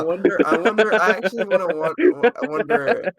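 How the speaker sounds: high, loud hammed-up wondering; mock eerie voice. Host teases listeners who stayed, mock eerie musing. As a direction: put on a theatrical mystery voice. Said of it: "dramatic spooky musing voice".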